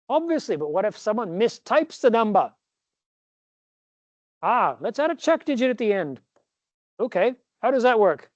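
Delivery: put-on a lightly held British accent through the dialogue; both booksellers in mock British; teacher acting out a British exchange; fake British accent. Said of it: "practice my fake British accent".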